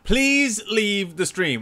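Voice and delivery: high, long drawn-out plea; mock plea. Host to viewers before spoilers, drawn-out mock plea. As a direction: beg them, stretching the words right out.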